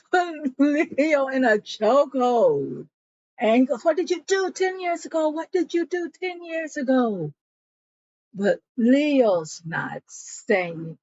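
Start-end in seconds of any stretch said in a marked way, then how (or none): 3.8-7.3 higher, fast, repeated demand; mock fierce grilling; acted interrogation. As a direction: squeeze the truth out of him. Chokehold interrogation.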